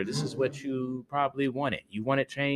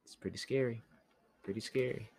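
hushed; 10 dB quieter, faster than usual. Real, not acted. Hushed spooky reaction to story.

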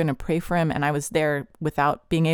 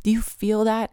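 higher-pitched, jeering questions; sneering tempter pitched up; taunting enemy voice; 7 semitones higher than usual. Retold or imitated. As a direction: taunt him with each pointed question. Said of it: "the voice of the enemy saying".

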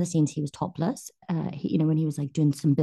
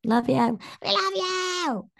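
high slow gushing "we love you"; mock gush; 14 semitones higher, slower than usual. Guest echoing a fan's love in a slow high gush. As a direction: swoon it out like a fan.

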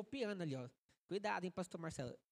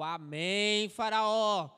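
pastor shouting; 10 dB louder, much slower than usual. Retold or imitated. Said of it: "Pastor gritando no banco".

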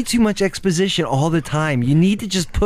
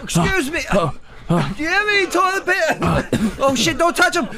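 much higher, frantic character voice; high panicked voice; 13 semitones higher than usual. Acted. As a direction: squeal it out in panic.

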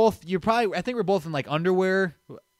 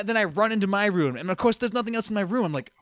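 ordinary talk, nothing marked

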